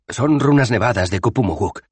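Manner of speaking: Rincewind's voice. Acted. Rincewind naming the runes, high and emphatic. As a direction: state it knowingly.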